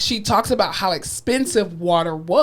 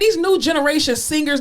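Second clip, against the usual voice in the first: usual speaking voice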